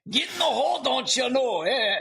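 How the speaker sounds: high, loud hammed-up Scottish shout; Scottish accent. Mock Scottish accent yelling a golf cheer. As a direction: belt it like a Scot cheering a putt. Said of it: "Maybe that's a little Scottish".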